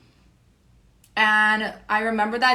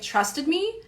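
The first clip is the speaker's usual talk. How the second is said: ordinary talk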